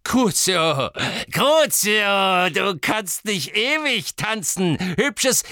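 high, gloating villain's taunt; Amicus, giggling. A narrator's reading.

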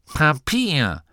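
high, pompous announcement; hochtrabend. A narrator's reading. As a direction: proclaim the word grandly. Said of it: "erklärte Gurda hochtrabend". (hochtrabend verkündet)

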